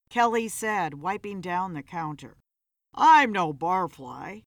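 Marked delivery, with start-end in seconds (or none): 2.9-4.4 sneered. A narrator's reading. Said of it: "Philip sneered at her".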